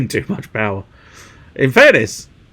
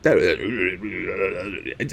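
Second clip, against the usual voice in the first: slow deep character voice; 4 semitones lower, 6 dB quieter than usual. Pretend. Voicing game character reply.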